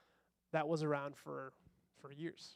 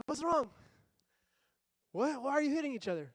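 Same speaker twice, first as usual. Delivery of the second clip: raised, higher voice of a parent breaking up a fight; parent calling out; 8 semitones higher, 8 dB louder than usual. Retold or imitated. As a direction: call over to the kids, louder and higher.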